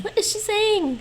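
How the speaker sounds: high, loud baffled outburst; exasperated. Real, not acted. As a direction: blurt it out in disbelief. Frustrated at fast app voice.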